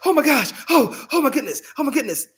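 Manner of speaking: gasping, high-pitched mock panic; acted alarm. Dad's morning act that catches his daughter, mock-alarmed. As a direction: gasp and rattle it out in alarm. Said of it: "he would go just straight face, serious".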